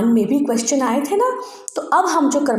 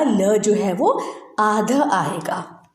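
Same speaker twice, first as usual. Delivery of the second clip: normal talking voice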